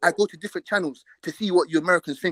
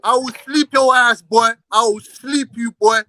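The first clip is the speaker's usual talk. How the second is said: slow, drawn-out raised threat; menacing threat; 3 semitones higher, 5 dB louder, slower than usual. Real, not acted. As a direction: stretch each word out as a warning. Repeated threat in a quarrel.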